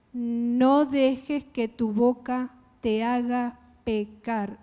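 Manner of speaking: very slow, deliberate scripture reading. Shown as a demonstration. Preacher reads a verse to the congregation, slowly and deliberately. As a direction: read it out slowly, word by word. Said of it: "Y lo leo despacio".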